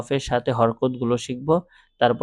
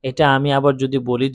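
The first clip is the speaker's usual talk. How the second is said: ordinary talk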